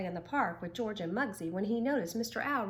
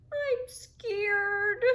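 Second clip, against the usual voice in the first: higher, tearful, frightened small voice; scared little turtle; 12 semitones higher, 6 dB louder than usual. A narrator's reading. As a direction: tremble out the words. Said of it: "a very young turtle trembling".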